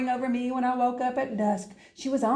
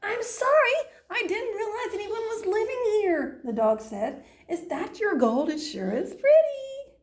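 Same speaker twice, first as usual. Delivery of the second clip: high, bright-eyed puppy voice; high sweet dog voice; 8 semitones higher than usual. Acted. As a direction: sound like an eager friendly pup. Cheerful dog voice, much higher.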